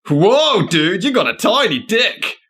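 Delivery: laid-back surfer bro exclaiming; surfer dude. Acted. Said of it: "keep coming up as a surfer dude".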